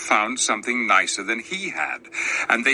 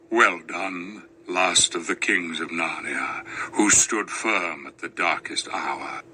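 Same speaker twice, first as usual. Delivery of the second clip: lower, grave and tender; deep lion voice; 7 semitones lower than usual. Acted. Aslan praises the last king kneeling at his feet, deep and gentle. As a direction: speak like a great kind lion. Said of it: "the lion kissed him and said".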